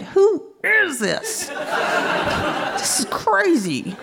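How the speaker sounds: high, drawn-out disbelief; comically amazed, slow and high; mock astonishment. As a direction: stretch the words out in high-pitched amazement. Acting out astonished thought mid-encounter.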